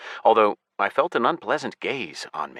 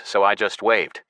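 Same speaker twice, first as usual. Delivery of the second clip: ordinary delivery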